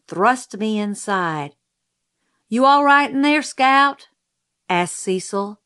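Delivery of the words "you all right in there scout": put-on raised, higher boyish call. A narrator's reading. Cecil asks Scout inside the costume, calling out. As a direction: call loudly to someone shut inside.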